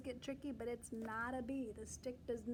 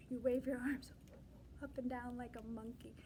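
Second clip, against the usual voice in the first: ordinary delivery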